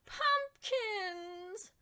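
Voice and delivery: high disgusted cry; cried in disgust. A narrator's reading. Rebecca Estelle spotting pumpkin plants, crying out in disgust. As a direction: spit the word out in disgust. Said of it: "she cried in disgust".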